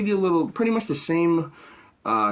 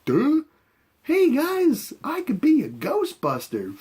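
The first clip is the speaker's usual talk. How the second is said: dopey guy voice; 11 semitones higher, slower than usual. Retold or imitated. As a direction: play him as a clueless doofus. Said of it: "was just like".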